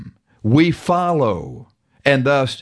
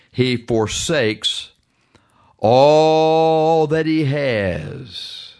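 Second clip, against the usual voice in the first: very slow, ALL held long; preacher lingering hard on the key phrase; drawn-out emphasis; much slower than usual. Real, not acted. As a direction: stretch out each word for weight.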